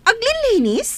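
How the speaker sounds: high disbelieving question; incredulous echo. Acted. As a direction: throw the word back, not buying it. Boss doubts they were cleaning. (saan a mamati, nangato a saludsod)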